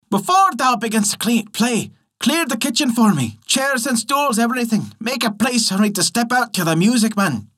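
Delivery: a lightly voiced fairy brat, eager to dance; cheeky changeling child. A narrator's reading. Changeling orders the tailor to clear the kitchen, bossy and high.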